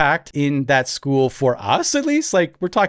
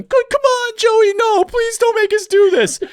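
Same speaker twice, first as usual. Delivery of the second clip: much higher whining plea with a laugh in it; whiny pleading kids; 4 dB louder than usual. Retold or imitated. Grade nines begging Joey not to send them in, mock whine. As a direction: beg in a squeaky mock whine. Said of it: "you hear them".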